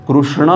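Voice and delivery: कृष्ण as "krushna", with a u vowel; variant pronunciation. Acted. Pandit asking which is right, offering "krushna". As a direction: say कृष्ण with "ru" in place of "ri". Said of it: "क्या सही है".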